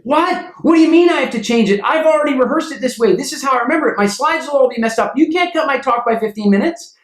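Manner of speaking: high, fast, indignant whining protest; mock a speaker throwing a tantrum; whiny complaining speaker.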